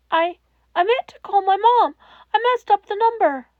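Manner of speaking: high, loud, stammering rush; panicked. Acted. Izuku panicking to All Might about the wrong number. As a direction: blurt it out in panic.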